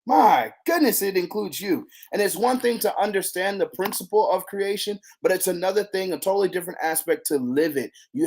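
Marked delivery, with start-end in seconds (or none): none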